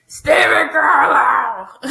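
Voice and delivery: drawn-out, gruff character holler; gruff shout. Retold or imitated. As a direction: bellow the name slowly in a mock gruff voice. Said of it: "that gruffy voice".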